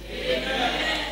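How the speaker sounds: barely voiced, whispered amen; a soft, hesitant congregation amen; faint amen. Real, not acted. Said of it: "Are you saying amen very well?".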